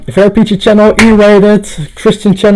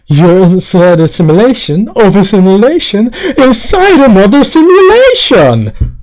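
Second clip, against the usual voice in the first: climbing higher and louder to the end; mounting mock outburst; 4 semitones higher, 4 dB louder than usual. Recalling Rick and Morty, mounting mock outburst. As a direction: build to a hammed-up high peak. Quotes show, rising to a peak.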